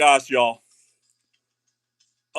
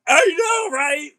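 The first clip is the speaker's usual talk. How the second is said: thrilled squeal; 10 semitones higher, slower than usual. Real, not acted. Reacting to lucky pull.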